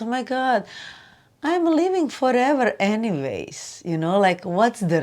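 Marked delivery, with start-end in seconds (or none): 0.0-3.8 high, soft, delighted discovery; a higher, softer aha, amused at herself; amused realization. Retold or imitated. Realizing she lives forever, softly amused and high. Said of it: "You realize".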